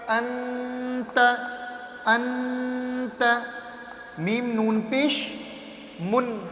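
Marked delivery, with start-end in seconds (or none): 0.1-3.2 "انت" joined slowly and drawn out; slow blending. Shown as a demonstration. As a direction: stretch "انت" very slowly as one sound. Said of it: "تا".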